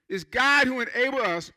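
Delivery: usual speaking voice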